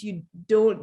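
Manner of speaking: ordinary talk, nothing marked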